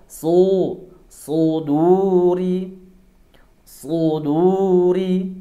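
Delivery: "Suduri" very slow, with a heavy emphatic s; thick so. Shown as a demonstration. Teacher models "Suduri" very slowly for students. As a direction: draw out "Suduri", tongue root high. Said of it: "ya, tebal".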